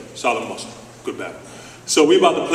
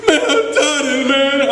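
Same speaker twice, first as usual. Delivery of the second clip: whiny fed-up player; 13 semitones higher, 4 dB louder, slower than usual. Retold or imitated.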